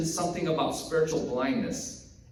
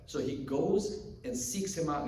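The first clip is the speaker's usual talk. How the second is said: usual speaking voice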